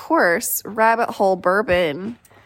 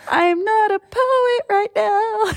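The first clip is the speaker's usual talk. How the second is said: mock self voice; 10 semitones higher, slower than usual. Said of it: "I was like".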